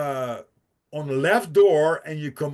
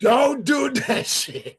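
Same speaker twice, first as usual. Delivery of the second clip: laughing warning; 10 semitones higher, 3 dB louder, slower than usual. Real, not acted.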